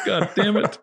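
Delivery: high exasperated outburst; frustrated yell. Acted. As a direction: cry out in frustration. Voicing Liu Kang's frustration.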